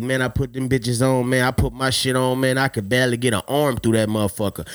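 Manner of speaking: ordinary talk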